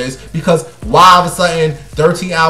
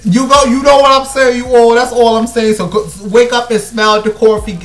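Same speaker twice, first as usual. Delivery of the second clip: fired-up preaching; 6 semitones higher, 3 dB louder than usual. Real, not acted. Fired-up advice to [name].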